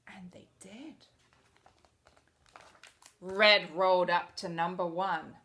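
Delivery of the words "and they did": hushed unvoiced whisper. Reader telling the kids the colors obeyed, whispered. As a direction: drop to a secret whisper.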